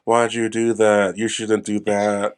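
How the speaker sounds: slow, flat mock actor delivery; wooden line reading. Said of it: "very just wooden and shallow".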